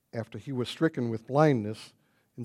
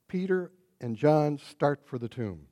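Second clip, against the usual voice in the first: ordinary delivery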